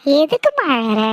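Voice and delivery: ordinary delivery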